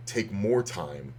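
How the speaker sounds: ordinary delivery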